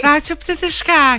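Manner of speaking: high, slow puppet voice; squeaky duck puppet; Orville voice. Acted. Orville impression from the talk.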